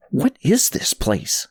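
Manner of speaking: plain reading voice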